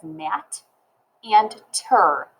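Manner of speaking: "mat" and "ter" as separate chunks; sounded out in syllables. Shown as a demonstration. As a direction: say "mat", pause, then "and ter". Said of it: "I can sound it out as".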